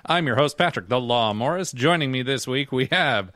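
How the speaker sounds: upbeat, loud show intro; podcast host intro. Acted. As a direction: welcome the listeners like an announcer. Opens the podcast episode.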